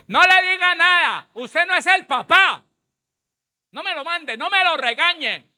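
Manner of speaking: mock the mother's shrill scolding in a high voice. Mother scolding man, mocked. (voz burlona de madre regañona muy aguda)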